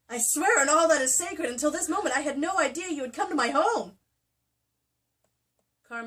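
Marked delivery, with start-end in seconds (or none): 0.1-4.0 loud, higher, melodramatic vow; overacted oath. Carr swearing innocence to Rhiannon, hammed-up and loud. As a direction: swear it to the rafters.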